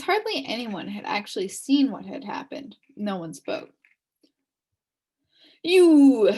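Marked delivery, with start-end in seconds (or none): 5.6-6.3 loud, pointed accusation; accusing bark. Acted. Policeman singling out the humbug, accusing bark. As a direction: stab the word at him.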